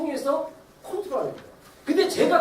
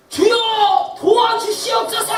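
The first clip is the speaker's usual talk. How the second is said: much louder, higher desperate cry; crying out to God; 9 semitones higher, 7 dB louder, much slower than usual. Shown as a demonstration. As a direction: cry out to God for help with all you have. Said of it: "소리 질러야 돼요". (훨씬 크고 높게 하나님께 부르짖음)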